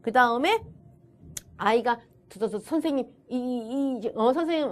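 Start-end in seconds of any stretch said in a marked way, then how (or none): 2.3-4.7 acted stammer on "저" and "이"; stuttering child. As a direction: hesitantly repeat "저" and "이", struggling toward the word. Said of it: "더듬었을 때는".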